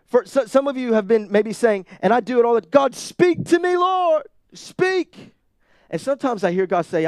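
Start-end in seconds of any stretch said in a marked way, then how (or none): 2.6-5.3 high, loud cry up to God; loud pleading prayer. Acted. Believer begging God to speak, loud high pleading. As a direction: plead loudly, pitched way up.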